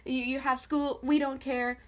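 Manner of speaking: usual speaking voice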